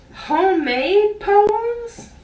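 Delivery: high, slow scornful disbelief; a high, drawn-out sneering question; incredulous. Retold or imitated. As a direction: drag it out in mock outrage at the idea. Scorn at a classmate's homemade gift.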